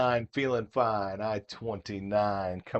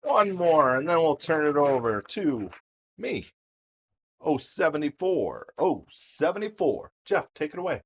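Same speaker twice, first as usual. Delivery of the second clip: excited; 4 semitones higher, 6 dB quieter than usual. Acted. Hands over to Jeff.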